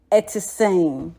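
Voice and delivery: "Ete seng" with a short falling vowel; Twi greeting. Shown as a demonstration. Teacher shows a Ghanaian how to say "Ete seng". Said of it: "it goes down".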